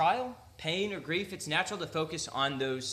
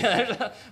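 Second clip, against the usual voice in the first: laughing burst; 7 semitones higher, 6 dB louder than usual. Real, not acted. Surprised laugh at the verse.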